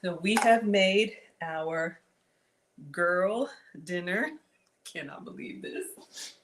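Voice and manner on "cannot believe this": breathy whisper of disbelief. Real, not acted. Amazed at the dish.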